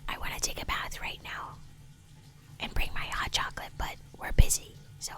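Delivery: soft ASMR whisper. Acted. Gamer whispering to viewers during the in-game bath, soft ASMR whisper. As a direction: whisper gently, as if not to disturb the bath.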